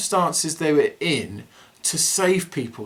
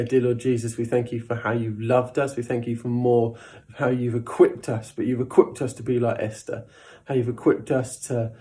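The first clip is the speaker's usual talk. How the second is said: prayer; 5 semitones lower than usual. Real, not acted. Leads closing prayer.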